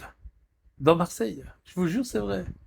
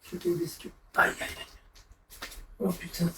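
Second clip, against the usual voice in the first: streamer seized by a cramp cries out in pain, then winces; 3 semitones higher, 9 dB quieter than usual. Real, not acted. Streamer with cramp, pained cry. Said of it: "j'ai des crampes".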